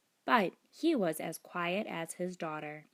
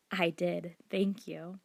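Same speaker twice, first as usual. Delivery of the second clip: ordinary delivery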